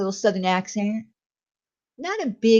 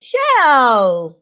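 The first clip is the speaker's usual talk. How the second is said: calling out; 4 semitones higher, 7 dB louder than usual. Real, not acted. Greeting a chatter by name.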